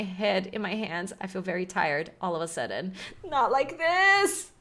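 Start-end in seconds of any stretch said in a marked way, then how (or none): 3.2-4.5 mock despair. Melodramatic despair.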